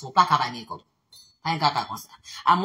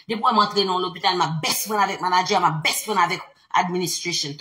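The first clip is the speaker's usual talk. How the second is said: ordinary talk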